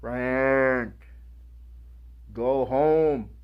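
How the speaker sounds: lightly voiced God, calm and commanding. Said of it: "I hear his voice".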